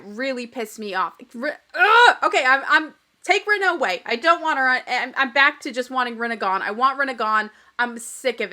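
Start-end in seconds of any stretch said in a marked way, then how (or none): 1.3-4.4 loud, frustrated groan cutting off a name; real frustrated cry, louder; fed-up outburst. Real, not acted. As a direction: burst out in real annoyance. Vlogger fed up with Rinna.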